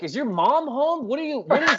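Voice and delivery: mock-confused officer, barely acted; imitating the cops. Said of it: "They were like".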